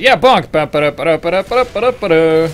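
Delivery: loud, high sung victory tune; excited bonk cry. Pretend. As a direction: cheer and hum the scout's run. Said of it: "He's like".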